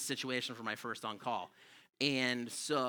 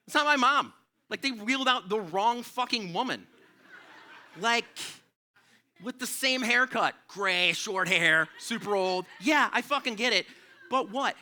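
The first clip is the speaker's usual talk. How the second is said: incredulous outburst; 8 semitones higher, 5 dB louder than usual. Real, not acted. Wrong woman wheeled out.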